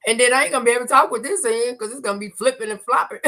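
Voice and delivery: fast, high giggly talk; laughing. Real, not acted. Laughing about loose teeth.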